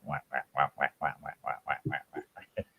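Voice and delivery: soft, low, quiet whack imitation; hushed imitated goose call; quiet goose honk. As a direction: mimic a goose murmuring in your ear. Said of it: "he'd just go real quiet".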